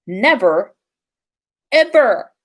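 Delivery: plain reading voice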